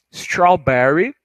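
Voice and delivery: "strawberry" stretched out slowly, each syllable clear. Shown as a demonstration. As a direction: draw "strawberry" out slowly for learners. ("strawberry" bem devagar, sílaba por sílaba)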